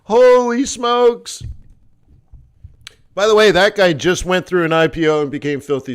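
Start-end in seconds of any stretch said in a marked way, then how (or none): 0.0-1.4 loud, high and drawn-out holy smokes; big slow Holy smokes; amazed exclamation. Retold or imitated. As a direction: marvel at the nerve of it. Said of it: "I'm like".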